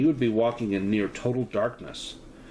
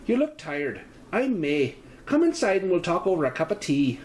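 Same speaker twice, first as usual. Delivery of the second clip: lightly lilted Irish landlady, friendly; a hint of Irish warmth, inviting; 6 semitones higher than usual. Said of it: "Her voice has a slight Irish lilt".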